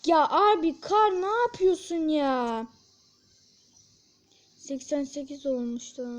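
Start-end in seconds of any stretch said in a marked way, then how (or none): 0.0-2.7 slow, exasperated yell at a teammate; frustrated yell. Real, not acted. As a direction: drag it out in disbelief. Teammate messing up in game. (sinirli, uzatarak bağırma)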